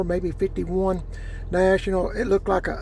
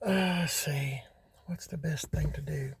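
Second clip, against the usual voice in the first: muttering to himself; 6 dB quieter than usual. Real, not acted. Moving car, talking to himself.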